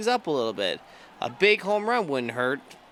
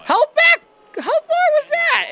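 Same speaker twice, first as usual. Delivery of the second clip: far higher and louder, shrieking until the voice cracks; voice-cracking home run call; 10 dB louder, slower than usual. Real, not acted. Gamer calling a monster homer, voice cracking. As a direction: scream it so hard the voice breaks. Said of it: "And my voice just cracked".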